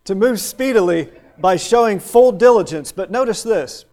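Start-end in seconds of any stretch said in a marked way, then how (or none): 0.0-2.9 full diligence hit louder, speedily left light; stress on full diligence. Shown as a demonstration. Preacher repeats the definition, punching full diligence. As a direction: lean hard on full diligence, not on speedily. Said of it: "The emphasis is on the full diligence".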